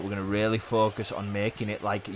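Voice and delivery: ordinary talk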